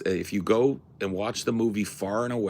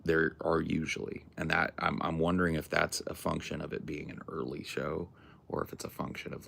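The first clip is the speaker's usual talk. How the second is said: ordinary delivery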